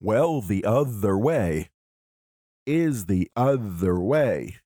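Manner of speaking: slow scansion of "the other way", syllable by syllable; measured rhythm, leaning on "other" and "way"; iambic stresses. Shown as a demonstration. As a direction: beat out "other way" in alternating stresses. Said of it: "are mostly iambic".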